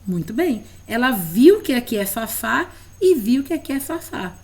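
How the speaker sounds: ordinary talk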